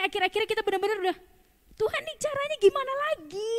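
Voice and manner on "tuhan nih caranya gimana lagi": pleading high cry to God; a worshipper at wit's end begging; desperate prayer. Retold or imitated. Worshipper at a dead end cries out to God, high and pleading.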